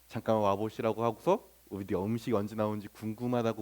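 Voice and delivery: soft, low, unhurried request; gentle polite asking. Acted. Considerate diner asking the waiter about late food, gently. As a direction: ask softly, without any reproach. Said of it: "더 부드러운 태도로".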